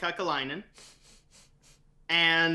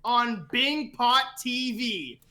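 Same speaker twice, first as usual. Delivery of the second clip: loud, higher, slow grand announcer voice; ring announcer intro; 5 semitones higher, 4 dB louder than usual. Pretend. Cohost giving a guest a proper grand intro, booming announcer. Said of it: "I have to actually give him a proper intro".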